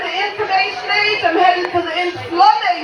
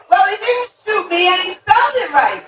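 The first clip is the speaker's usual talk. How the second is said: normal talking voice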